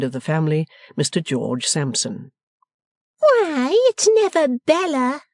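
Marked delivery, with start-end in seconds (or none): 3.2-5.2 high, loud squeal of surprise; astonished exclamation. A narrator's reading. Miss Lavvy starting back at Bella's arrival, exclaiming. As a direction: exclaim in high-pitched disbelief. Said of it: "exclaimed Miss Lavvy".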